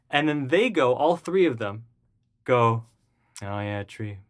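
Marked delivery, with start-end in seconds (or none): 3.4-4.2 low, soft, deadpan acknowledgement; retold unconcern. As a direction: imitate people who couldn't care less. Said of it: "They didn't even care".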